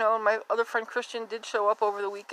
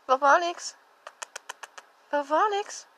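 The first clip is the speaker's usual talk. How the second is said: calling out; 7 semitones higher, 4 dB louder than usual. Real, not acted. Calling the kitten by name.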